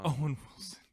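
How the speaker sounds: breathy, whispery actor impression; Owen Wilson. Echoing the co-host's pick, whispery actor impression. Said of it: "fun to talk like Owen Wilson".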